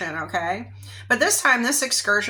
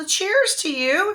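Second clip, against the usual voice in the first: high, loud, sing-song fake friendliness; sweet on the surface, sly underneath; fake-friendly girl; 13 semitones higher, 4 dB louder, slower than usual. The pushy girl greets her target, falsely sweet. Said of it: "she cheers you".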